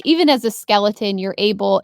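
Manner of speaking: normal talking voice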